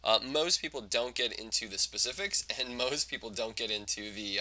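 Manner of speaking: ordinary talk, nothing marked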